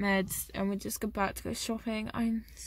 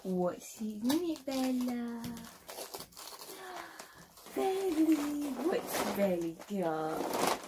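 talking to a dog; 2 semitones higher, 5 dB quieter, slower than usual. Real, not acted. Asking the dog about treat.

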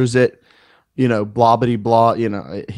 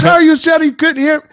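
much higher, louder squeaky voice of the caller; mock the angry caller, pitched way up; 4 dB louder than usual. Quoting the furious caller, squeaky high mockery. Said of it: "he's like".

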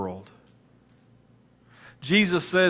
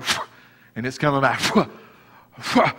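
blowing at wasp. Retold or imitated.

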